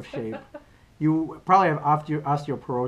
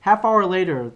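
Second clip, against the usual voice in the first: ordinary talk, nothing marked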